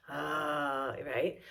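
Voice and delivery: low resonant ah from the chest; deep chest-voice ah. Shown as a demonstration. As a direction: feel it vibrate down in the chest. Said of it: "practice like that".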